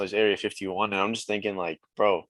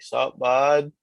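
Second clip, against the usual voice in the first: louder, higher Canadian-accented greeting; 5 semitones higher, 6 dB louder than usual. Shown as a demonstration. Canadian showing how to greet someone, playful Canadian accent. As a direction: greet a pal in broad Canadian. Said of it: "I can hear your Canadian accent".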